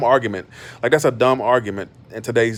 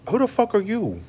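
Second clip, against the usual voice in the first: pitched way up in disbelief; who do you think you are, indignant; incredulous outburst; 9 semitones higher, 5 dB quieter than usual. Real, not acted. To the commenter who said she shouldn't go off, incredulous.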